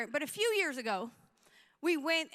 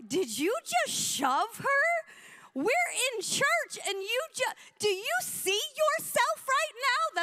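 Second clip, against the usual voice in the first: high, slow incredulous scolding, building; outraged confrontation; 9 semitones higher, 4 dB louder, slower than usual. Retold or imitated. Pastor to lady who shoved in church, mock-outraged scolding. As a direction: stretch each word in hammed-up outrage.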